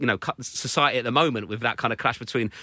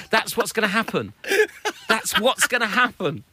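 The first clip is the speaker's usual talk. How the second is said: exasperated rant; 5 semitones higher than usual. Retold or imitated. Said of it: "I'm like".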